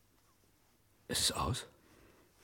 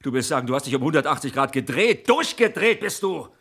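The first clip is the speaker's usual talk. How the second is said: angry shout; 5 semitones higher, 7 dB louder than usual. Acted. Wife's shocking confession.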